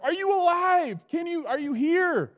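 loud call high down into a pit. Acted. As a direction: shout down to see if he lives. King calls down into den.

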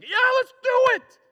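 high, loud eager shout; super excited. Retold or imitated. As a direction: burst out with wild eagerness. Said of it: "Super excited".

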